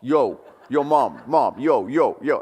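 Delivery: a loud mock Rocky Balboa "yo, Mom"; hammed-up boxer calling through the house; imitating Rocky. Kid pestering Mom as Rocky, loud mock impression. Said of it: "just going".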